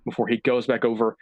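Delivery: usual speaking voice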